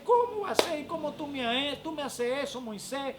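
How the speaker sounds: high-pitched, loud retold protest; imitating indignant wife. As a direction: act out a wife's shocked complaint. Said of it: "Me dice".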